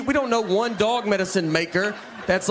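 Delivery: ordinary talk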